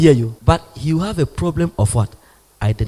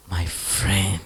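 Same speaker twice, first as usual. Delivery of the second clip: whispered; 8 semitones lower, 7 dB quieter than usual. Retold or imitated. God's tender words, whispered.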